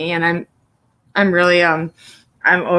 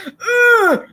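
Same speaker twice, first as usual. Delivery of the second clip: strained grunt; 13 semitones higher, 4 dB louder than usual. Retold or imitated. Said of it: "So he goes".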